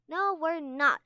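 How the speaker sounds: loud defiant denial. A narrator's reading. Tsunami defying Castell, loud and defiant. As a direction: fire it straight back. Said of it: "Tsunami shot the Sandwing glare".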